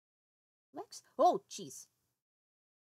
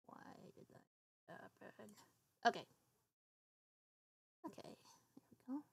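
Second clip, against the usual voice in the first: whispering; 3 semitones lower, 13 dB quieter than usual. Real, not acted. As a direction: breathe the words out without voice. Fixing setup near the mic.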